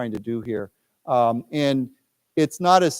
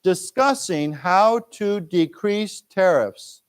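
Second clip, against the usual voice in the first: very slow, higher model reading; 4 semitones higher, much slower than usual. Shown as a demonstration. Teacher reads line for students to echo, very slow. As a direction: spell it out slowly for learners. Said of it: "let's read this".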